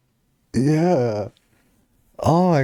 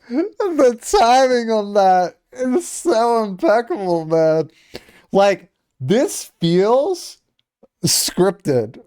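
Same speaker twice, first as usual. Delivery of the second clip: high, slow, giddy disbelief; giddy amazement; 11 semitones higher, 3 dB louder, much slower than usual. Real, not acted. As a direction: still giggly, marvel at it. Giddy reaction after laughing.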